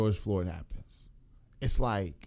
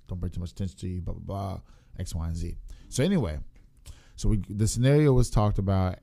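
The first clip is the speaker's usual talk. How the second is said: normal talking voice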